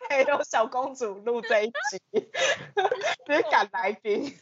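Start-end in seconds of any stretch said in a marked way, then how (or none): 2.0-3.2 high-pitched rising laugh; high giggle. Real, not acted. Laughing at kicking guest out.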